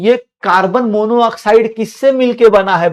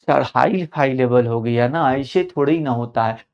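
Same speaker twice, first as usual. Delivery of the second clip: a hint of a lower, softer, sulky pupil; whining student; 7 semitones lower, 7 dB quieter than usual. Student grumbling to sir that it is too hard, low and soft. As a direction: mumble the protest like a fed-up student.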